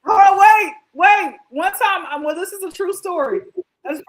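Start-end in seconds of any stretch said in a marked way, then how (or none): 0.0-1.3 loud, higher-pitched cut-in; urgent interjection. Real, not acted. Host halts co-hosts to tell a true story, urgent. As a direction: burst in to stop the conversation.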